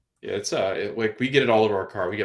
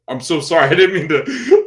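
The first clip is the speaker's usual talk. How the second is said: high, loud panicky apology; flustered apology; 8 semitones higher, 11 dB louder than usual. Retold or imitated. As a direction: blurt out a frantic sorry. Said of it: "I was like".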